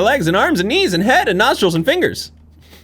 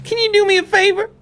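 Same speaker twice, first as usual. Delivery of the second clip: high Susie Rabbit voice. Acted. Susie Rabbit asking the detective a favor, high pitched. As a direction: voice up high, chirpy bunny girl.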